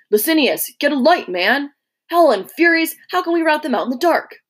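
loud, high, furious barking of orders; angry shout. A narrator's reading. Centurion yelling at his man in the dark, angry shout. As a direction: roar at Licinius to fetch a light.

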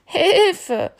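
high, loud mock cry for help; frightened cry. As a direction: goat kid squeals in fright. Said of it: "sagte das Ziegenkind". (hoher, lauter Hilferuf)

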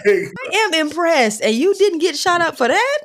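high-pitched incredulous question; mock amazement. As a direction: marvel at it in playful disbelief. Mock amazement at the film scene.

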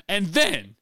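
high, loud mock coach holler; hammed-up movie coach; coach impression. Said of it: "He says John Brown".

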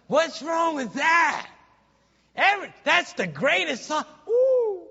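high, loud cry of mock outrage ending in a whoop; loud gushing protest, then a high woo; excited shout. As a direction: shout it high like a thrilled fan. Acts out a defender of the song.

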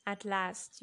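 usual speaking voice

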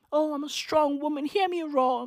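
lightly hammed-up diva sneer; mock strong woman. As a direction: sarcastically play the proud clapback queen. Mocking Chrissy Teigen's tweet persona.

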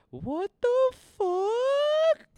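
very high, drawn-out falsetto squeal; shocked disbelief. Retold or imitated. Storyteller replaying his shock at the news, shocked disbelief. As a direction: stretch it out in a loud, mock-stunned squeak. Retelling shocked reaction to friend.

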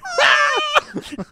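very high squealing laugh; shrieking laugh. Retold or imitated. Recounting hearing the uncut swearing, shrieking high laugh. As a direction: re-enact a helpless high-pitched cackle. Re-enacting own reaction to the swearing.

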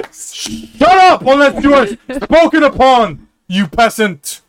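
high snapping royal rebuke; haughty scolding. Acted. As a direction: put the peasant in place. Prince scolds herald.

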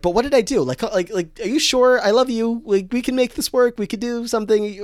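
high, desperate pleading; pleading Blaine. Retold or imitated. Recapping Blaine begging Kurt after the breakup, high pleading. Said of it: "He's like".

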